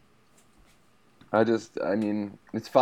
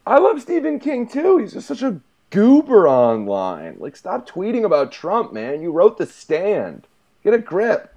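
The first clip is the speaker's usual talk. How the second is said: much higher and louder, worked up; high excited rant; 11 semitones higher, 6 dB louder than usual. Real, not acted. Host ranting about Stephen King's tweets, high and loud. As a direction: squeal and scold at once.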